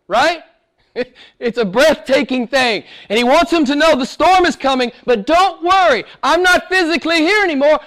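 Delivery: normal talking voice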